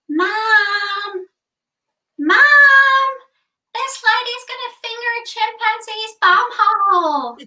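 put-on high-pitched child. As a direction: squeal it like a tattling child. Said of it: "really high-pitched voice go".

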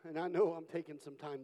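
ordinary delivery

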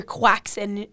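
"quaxin" as KWAK-sin; strong-accent croissant. Retold or imitated. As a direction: imitate a mangled croissant, "quaxin". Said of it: "his accent is so strong".

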